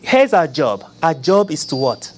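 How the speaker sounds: ordinary talk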